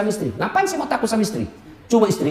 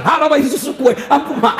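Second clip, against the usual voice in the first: mock mother's curse; 4 semitones higher than usual. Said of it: "Langsung dia bilang".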